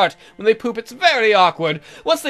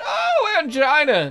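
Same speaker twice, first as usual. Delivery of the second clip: mock alarm; 9 semitones higher, slower than usual. Jokey self-correction cry.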